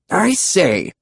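British pterodactyl, pitched high; high British character voice; British accent. A narrator's reading. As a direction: ask as a very British dinosaur. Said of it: "British accents were unexpected".

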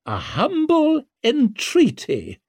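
high, drawn-out menacing politeness; veiled threat. A narrator's reading. Noblewoman answering whether that is a threat, menacingly polite. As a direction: stretch it slowly, pitched high, like a warning. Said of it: "made in the very tone one might have used for a threat".

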